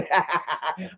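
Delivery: short, soft chuckle; laugh. Real, not acted. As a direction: chuckle at your own impression. Laughing after mock stern warning.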